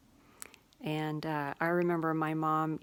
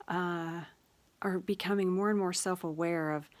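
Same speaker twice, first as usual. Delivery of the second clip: ordinary talk, nothing marked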